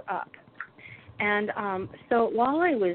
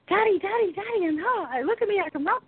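high-pitched eager dog voice; excited dog; 12 semitones higher than usual. Retold or imitated. Dog runs to husband, excited. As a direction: squeal it out like a thrilled pet. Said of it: "and he's like".

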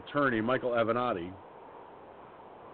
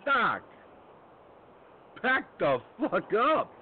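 angry retort; 10 semitones higher, 3 dB louder, slower than usual. Retold or imitated. Telling off the poking doctor.

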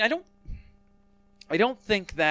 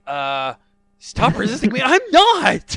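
mock strained struggle; 8 semitones higher, 11 dB louder, slower than usual. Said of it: "memory guy's like".

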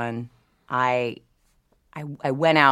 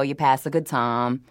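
ordinary talk, nothing marked

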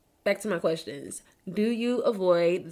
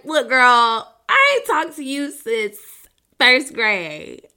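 put-on louder, sassy jab at an old friend; mock sassy reproach; 3 semitones higher, 9 dB louder, much slower than usual. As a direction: call her out with attitude, louder. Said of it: "you know, like".

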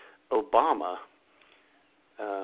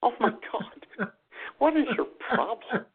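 exasperated; 11 semitones higher than usual. Retold or imitated. Exasperated reaction to her comment.

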